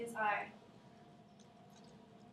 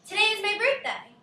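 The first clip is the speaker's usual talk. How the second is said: higher and louder, excited; 9 semitones higher, 8 dB louder than usual. Shown as a demonstration. Teacher showing the class an excited exclamation, higher and louder. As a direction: make it sound like a birthday. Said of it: "Does that sound excited, more excited?".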